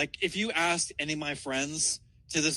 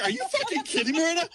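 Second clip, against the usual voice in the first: high, fast, laughing mock outrage; laughing disbelief; 3 dB louder, faster than usual. Retelling his shock at the ex's anniversary claim, laughing. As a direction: shriek it through laughter.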